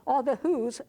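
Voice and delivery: plain reading voice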